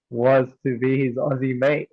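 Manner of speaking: usual speaking voice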